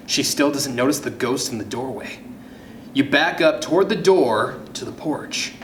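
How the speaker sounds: usual speaking voice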